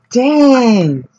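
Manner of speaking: loud, high, drawn-out retold amazement; amazed exclamation. Storyteller recalls reacting to a bold kid, amazed. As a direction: exclaim it high, falling away slowly.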